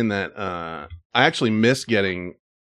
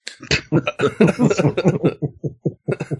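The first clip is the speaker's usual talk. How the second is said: long run of high chuckles; helpless laugh at own slip; real, drawn-out giggling; 5 semitones higher than usual. Real, not acted.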